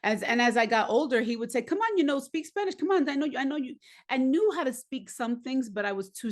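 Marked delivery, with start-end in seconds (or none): 1.6-3.8 coaxing father. Retold or imitated. Father coaxing her, voiced in story.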